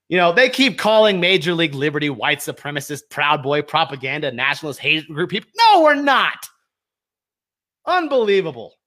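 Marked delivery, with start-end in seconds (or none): 5.6-6.5 higher, louder snapped denial; angry no to the accusers. Real, not acted. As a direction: shoot it down hard. Rejecting the label.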